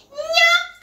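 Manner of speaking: very high, loud hawker's cry; street oil seller's call. Retold or imitated. Imitating the man selling oil round Jakarta houses, high street cry. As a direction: call out minyak like a street vendor. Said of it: "The sound like this".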